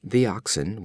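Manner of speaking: ordinary delivery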